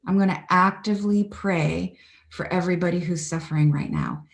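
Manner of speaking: ordinary talk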